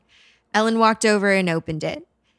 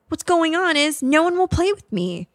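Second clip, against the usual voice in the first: put-on very high, whining child's complaint; high-pitched small boy's protest; upset little boy; 11 semitones higher, 4 dB louder than usual. A narrator's reading. Ghost boy complains nobody will play, high and upset.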